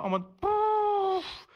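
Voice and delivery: a long, drawn-out, high "pouf"; pouf sound effect. Shown as a demonstration. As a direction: stretch the "pouf" way up high, like something swept far away. Signing "long ago" with a sound effect.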